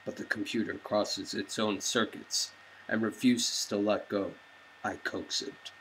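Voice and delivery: low, quiet first-person narration; brooding narration. Acted. Bruce narrating his race, low and brooding. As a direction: read it low and inward like a brooding hero.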